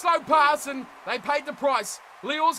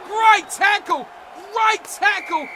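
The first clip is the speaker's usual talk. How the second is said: excited cheer; 7 semitones higher, 8 dB louder, slower than usual. Real, not acted. Cheering a tackle.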